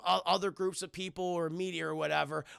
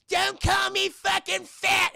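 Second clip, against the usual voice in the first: very high, loud, slow shriek of rage; furious yell; 12 semitones higher, 8 dB louder, slower than usual. Retold or imitated. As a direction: scream it like an insulted kid. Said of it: "Carmen's like".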